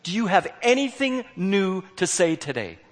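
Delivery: retold confrontation with a repeat visitor; exasperated challenge. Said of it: "I go".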